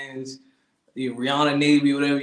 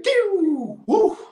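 high mock whoosh, pew then woo; high whoosh sounds; 14 semitones higher, 3 dB louder than usual. Telling a story about zapping someone, high whoosh noises. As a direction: toss off two high zap noises.

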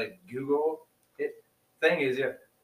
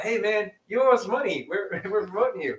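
high, loud playful hustle; mock demanding; 7 semitones higher, 5 dB louder than usual. Podcaster billing brands for unasked ads, high mock demand. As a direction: demand it jokingly, pitched up and pushy.

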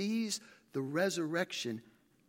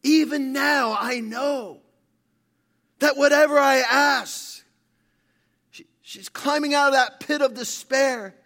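loud, high, ringing sermon delivery; full-force preaching; 9 semitones higher, 11 dB louder than usual. Acted. Preacher retelling Martha's hope to the congregation, full-force preaching. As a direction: preach it at full volume, building to the promise.

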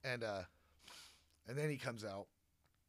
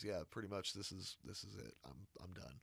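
tired resignation; 6 semitones lower, 6 dB quieter than usual. Retold or imitated. K resigned, retiring.

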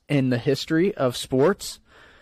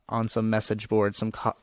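ordinary talk, nothing marked

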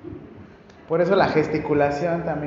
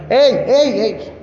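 mock yell, higher and louder on the first ey; yelling hey; 4 semitones higher, 5 dB louder, much slower than usual. Person rudely yelling hey at a blind man, loud. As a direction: call out ey ey ey rudely across the room. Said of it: "también le están gritando".

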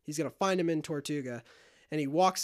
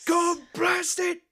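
high, loud, drawn-out curse; mock angry outburst; 13 semitones higher, 8 dB louder, much slower than usual. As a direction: yell like someone rudely doused awake. Gibbs doused awake with water.

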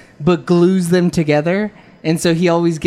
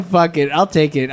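normal talking voice

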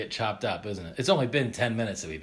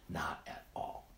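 whispered; 7 semitones lower, 14 dB quieter, slower than usual. Real, not acted. Punchline answer dropped low.

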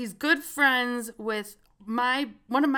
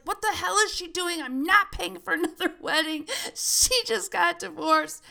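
higher-pitched mock yell of an angry father; dad fuming over a fake wedding post, half-shouted; retold yelling dad; 7 semitones higher than usual. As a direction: play the dad ranting about paying for another wedding. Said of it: "He's yelling".

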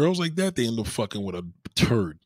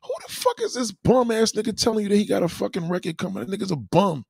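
high incredulous rant; mock the bum, pitched up; high indignant scoff; outraged; 9 semitones higher than usual. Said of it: "I'm like".

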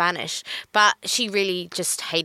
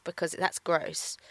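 disapproving; 3 semitones lower, 8 dB quieter, slower than usual. Retold or imitated. Retelling a disapproving online comment.

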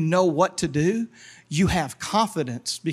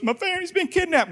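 high, rushed mock panic; mock alarm; 10 semitones higher, faster than usual. Man tells God of kidnapped family, in mock alarm. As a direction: cry it out high and quick, in play.

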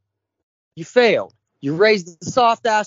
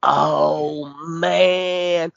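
mock awestruck fan; 5 semitones lower, much slower than usual. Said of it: "and we'd have been like".

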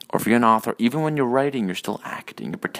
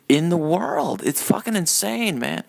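lightly put-on Biden impression, pitched up; hint of an exasperated elder statesman, higher voice; 8 semitones higher than usual. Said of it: "my, uh, Biden impression".

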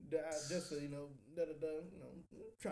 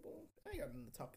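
ordinary talk, nothing marked

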